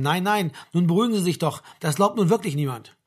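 ordinary delivery, nothing marked

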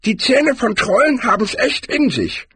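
very high-pitched barbarian character voice; high character voice, far from the narrator's; Cohen. A narrator's reading. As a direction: squeak it out high, explaining troll habits. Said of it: "fuhr Cohen fort".

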